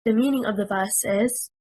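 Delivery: normal talking voice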